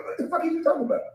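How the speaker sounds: high, fast laughing scoff; laughing disbelief. Real, not acted. Mocking disbelief at a claim.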